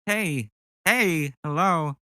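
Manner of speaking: lightly done Walken impression; Christopher Walken cat. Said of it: "a cat has Christopher Walken's voice".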